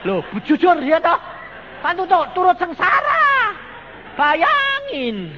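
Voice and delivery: high, full-force preacher's cry; loud exclamation. Real, not acted. Preacher tells congregation he shares the misery, loud high wail. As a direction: cry out with drawn-out word endings. Exclaims over sharing father's ruin.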